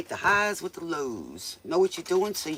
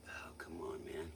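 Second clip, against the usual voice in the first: whispering; 11 semitones lower, 17 dB quieter than usual. Real, not acted. Frustrated whisper with cables.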